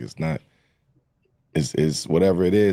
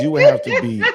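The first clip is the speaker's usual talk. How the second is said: laughing; 4 dB louder than usual. Real, not acted. Laughs mid-sentence in podcast debate.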